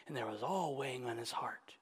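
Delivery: soft heavy whisper. Acted. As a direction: let the weight sink into a whisper. Heavy burden told softly.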